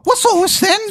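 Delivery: hammed-up English accent; bad English accent. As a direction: play a nosy English copper. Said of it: "you have a bad English accent".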